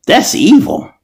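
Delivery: high mocking accuser squeak. Voicing TikTok's moderator calling him evil, high mocking squeak.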